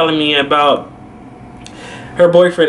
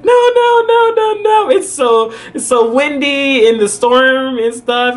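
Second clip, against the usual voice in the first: high, rapid string of no's, easing off; mock high-pitched pleading; imitated friend protesting; 11 semitones higher than usual. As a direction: pitch it way up to talk someone out of it.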